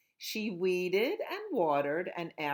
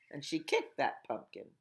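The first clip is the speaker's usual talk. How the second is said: ordinary delivery, nothing marked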